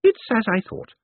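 put-on high old lady voice, appraising; Missus Lavish. A narrator's reading. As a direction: size up a newcomer as a feisty elderly lady. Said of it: "she said".

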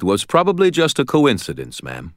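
put-on lightly accented Russian man. A narrator's reading. Rescuer answering grateful landlady, light Russian accent. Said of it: "his Russian accent less heavy".

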